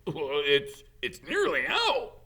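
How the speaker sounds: giddy, can barely contain it; excited. Acted. Hagrid excited at the egg.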